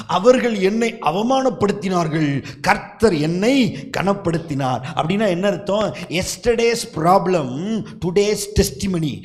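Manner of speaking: loud, high, rising sermon cry; fiery revival preacher; full-force preaching. Acted. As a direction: preach it out to the whole hall.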